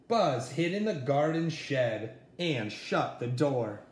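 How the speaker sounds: plain reading voice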